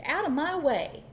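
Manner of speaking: indignant storybook goose; goose's cry. A narrator's reading. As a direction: order them aside. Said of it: "cried the goose".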